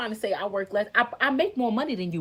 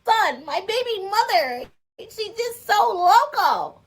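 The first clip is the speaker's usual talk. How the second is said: mocking the ex; 11 semitones higher, 5 dB louder than usual. Mocking ex's words.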